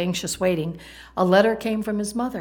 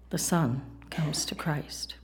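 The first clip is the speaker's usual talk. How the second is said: slow, breathy hushed whisper; slow whisper at the climax of the story; 3 semitones lower, 6 dB quieter, slower than usual. Real, not acted. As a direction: drop to a reverent whisper.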